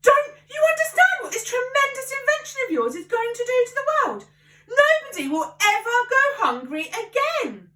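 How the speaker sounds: high, loud, worked-up father; Mister Cranky carried away by his invention. A narrator's reading. Father lectures George on the invention, high and worked up. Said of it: "working himself up so much".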